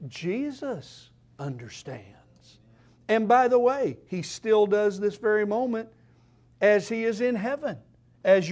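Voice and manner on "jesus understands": soft, low and slow; tender hushed reply; hushed answer. Acted. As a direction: lower the voice to comfort. Soft answer after quote.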